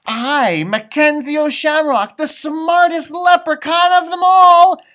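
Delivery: loud, high declaration; big boastful announcement; indignant proclamation. A narrator's reading. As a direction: proclaim the grand title at the top of your voice. Leprechaun proclaims himself in story.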